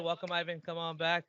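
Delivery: ordinary delivery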